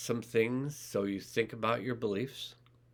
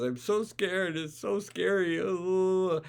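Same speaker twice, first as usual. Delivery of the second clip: much higher, squealing mock terror; hammed-up fear; 9 semitones higher, 3 dB louder, slower than usual. Shown as a demonstration. Coach acting out fear for viewers, hammed-up scared. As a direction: ham up being scared with a rising ooh. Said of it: "I know I'm faking it a little bit".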